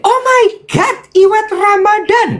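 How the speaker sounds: imitating a very high, thrilled "oh my God"; gushing greeting. Minister's wife greeting the host, mock high gushing squeal. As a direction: squeal her name like a delighted fan. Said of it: "Terus dia bilang".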